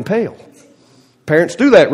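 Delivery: usual speaking voice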